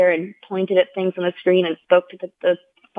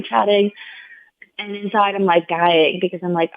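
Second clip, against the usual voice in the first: ordinary talk, nothing marked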